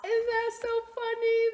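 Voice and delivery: high, loud, gushing delight. Real, not acted. Librarian gushing to the kids about her joke. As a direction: squeal it with glee.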